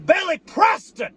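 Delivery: Billy Preston!; excited call. Real, not acted. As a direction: call his name out like an announcer. Hyping himself up.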